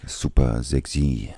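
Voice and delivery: drawn-out, sultry mock Italian; mock Italian accent. Co-host showing how Italians would say it, mock Italian accent. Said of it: "I can't do the Italian".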